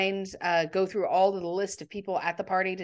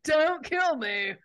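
imitating a bad fake American accent, higher and slow; a deliberately terrible American accent, pitched up; awful American accent; 7 semitones higher, slower than usual. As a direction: ham up Rickman's clumsy American accent, drawn out. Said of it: "puts on this really awful American accent".

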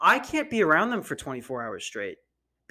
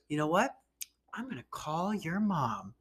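mock mean teacher, getting softer on the threat; nasty teacher threat; 6 dB quieter than usual. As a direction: imitate a teacher's quiet, spiteful threat. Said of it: "She said it like that".

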